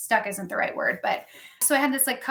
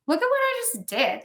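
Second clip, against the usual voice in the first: proud excitement; 10 semitones higher than usual. Retold or imitated. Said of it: "but then I was like".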